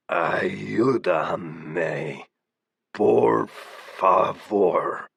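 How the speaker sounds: raspy voice. A narrator's reading. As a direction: drag each word out in a harsh rasp. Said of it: "he spoke in his horribly raspy voice".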